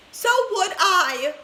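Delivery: high, loud, slow agreement; second grandma crying out agreement. A narrator's reading. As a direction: wail along in refusal. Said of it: "cried Grandma Georgina".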